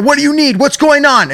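high, impatient mock snap; mock angry agent. Agent impatiently demanding what the caller needs. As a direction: fire the questions like you're annoyed. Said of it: "the most angriest".

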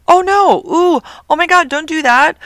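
high, loud alarmed shriek; panicked cry. Retold or imitated. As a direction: cry out in panic as the bird's head snaps back. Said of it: "I'm like".

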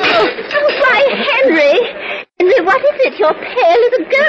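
high, loud cry of distress; alarmed wife. Acted. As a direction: panic over a suddenly sick husband. Wife sees husband suddenly taken ill.

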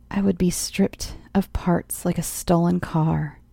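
usual speaking voice

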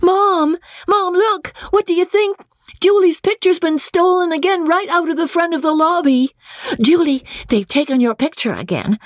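put-on very high excited child's voice; high, sharp excitement. A narrator's reading. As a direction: burst in shouting news like a little girl. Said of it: "child's voice high and sharp with excitement".

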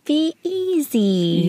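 joining in on the little girl impression, slow and high; imitating a little girl. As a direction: draw it out like a small child.

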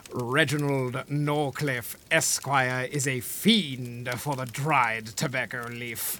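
low, soft, slow villain purr; posh gentleman voice. Acted. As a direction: savour each word like a dandy. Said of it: "Please explain it exactly like that".

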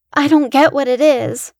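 frightened. Acted. Frightened boy at window.

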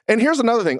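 ordinary delivery

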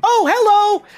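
high, loud, over-eager hello; enthusiastic guide. Acted. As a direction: burst into an excited greeting. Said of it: "So enthusiastic".

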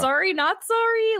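high, smug sorry not sorry; cheeky mock apology. Agent taunting the angry rival agent, high and smug. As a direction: toss it off with a smirk.

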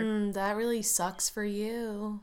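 slow, soft, unbothered imitation; mock unemotional. Wife watching her husband get caught, mock indifferent. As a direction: play it indifferent and unhurried. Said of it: "She doesn't show any emotion".

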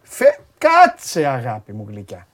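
αργά, πιο χαμηλά, κοροϊδευτικά γλυκά; σαν να παρηγορεί ειρωνικά; ψεύτικη τρυφερότητα. Pretend. Πειράζει την οπαδό της City. (πειράζει τη συνάδελφο με ψεύτικα γλυκό, αργό τόνο)

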